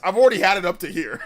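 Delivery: exasperated through a laugh. Real, not acted. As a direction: laugh it out, fed up.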